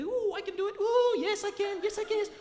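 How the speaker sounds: high-pitched, eager self-encouragement; mock-excited little pep talk; psyching himself up. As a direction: squeak it out in comic determination.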